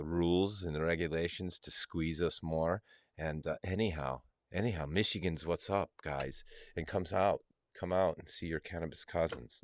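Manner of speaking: ordinary delivery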